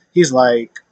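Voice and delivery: usual speaking voice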